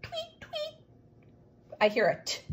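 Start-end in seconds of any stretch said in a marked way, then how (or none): 0.0-0.8 high, soft chick tweet. Shown as a demonstration. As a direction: peep it out like a baby bird. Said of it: "They might say tweet".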